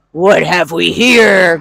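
a hint of a menacing tiger; growling tiger. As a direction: rumble it like a big cat. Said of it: "growled the tiger".

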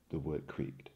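usual speaking voice

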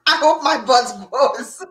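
laughing hard through the words, drawn out. Real, not acted. Joking about wished-for side effect.

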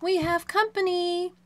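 high, loud storybook call; shouted. A narrator's reading. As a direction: call it out excitedly like a lookout. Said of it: "Deanna shouted".

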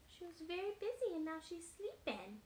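plain reading voice

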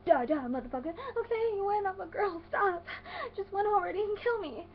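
high, whiny pleading while losing; panicked gamer. Shown as a demonstration. Losing player pleading with the game, hammed-up panic. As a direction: beg for mercy in a hammed-up wail. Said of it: "this is how I play video games".

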